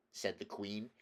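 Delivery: ordinary delivery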